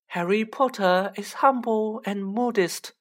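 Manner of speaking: high, reverent elf voice; small elf speaking in high-pitched reverence; reverent house-elf. Acted. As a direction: pitch it high, full of awe for a hero. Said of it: "said Dobby reverently".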